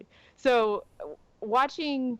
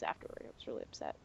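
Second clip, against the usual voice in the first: whispered confession, much softer; whispering; 16 dB quieter, faster than usual. Real, not acted. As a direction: breathe it as a whisper. Aside after ranting about Flash.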